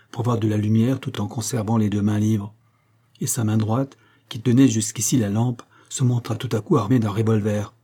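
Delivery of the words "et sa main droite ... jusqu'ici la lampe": usual speaking voice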